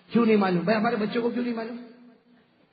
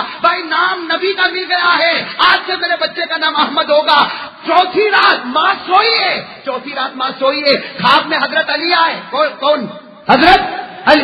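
loud, higher preaching at full force; fiery preaching; 7 semitones higher, 8 dB louder than usual. Acted. Preacher firing up the gathering about the saints, full-force preaching. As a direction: rouse the crowd, slow and booming.

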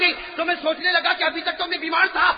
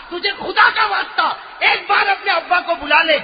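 desperate plea; 5 semitones higher, 6 dB louder than usual. Acted. Ali begs Fatima.

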